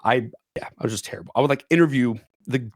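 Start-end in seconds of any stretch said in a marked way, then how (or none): none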